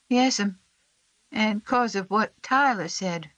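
put-on high, slow frightened child's voice; a sick little boy asking if he'll die; small boy, scared and pleading; Sam. A narrator's reading.

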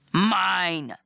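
drawn-out monster claim; brute creature. A narrator's reading. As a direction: insist like a lumbering brute. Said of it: "he repeated".

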